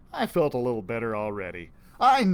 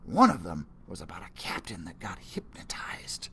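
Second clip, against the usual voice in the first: whisper; 6 semitones lower than usual. Acted. Spooky tale told low.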